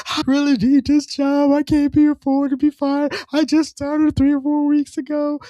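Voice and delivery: high-pitched tearful wail, hammed up; imitated crying. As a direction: plead through mock tears in a high voice. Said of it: "And then she starts crying".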